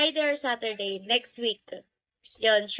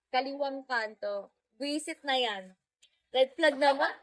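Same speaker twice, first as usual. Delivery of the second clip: usual speaking voice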